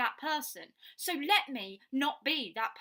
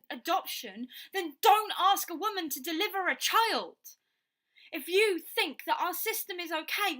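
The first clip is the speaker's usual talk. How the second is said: loud, higher, furious rant that cracks toward tears; angry outburst; 8 semitones higher, 8 dB louder than usual. Real, not acted. Ranting at people who oppose abortion, furious and near tears. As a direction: shout it, voice breaking with rage. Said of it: "he can tell that I'm getting angry and upset".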